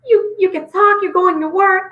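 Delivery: high, incredulous doctor voice; baffled doctor. Retold or imitated. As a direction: play the stunned oncologist who can't believe it. Oncologist voiced in retold story.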